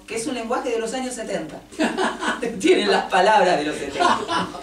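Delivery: laughing through the words; amused, breaking into laughter. Real, not acted. Laughs about seventies vocabulary.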